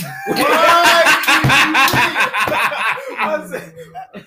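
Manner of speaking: bursting out laughing; big high laugh; loud laugh. Real, not acted. Friend's alien remark.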